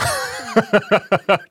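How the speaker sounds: high burst of laughter; real laugh. Real, not acted. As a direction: laugh out loud at the irony. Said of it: "laughs at own story".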